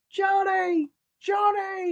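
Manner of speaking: high, drawn-out calling shout; shouting to friend. Retold or imitated. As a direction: imitate a man yelling for his mate. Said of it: "he's shouting his friend".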